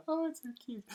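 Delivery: high, loud cooing at the cat. Real, not acted. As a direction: gush over the cat. Cat stealing the lead.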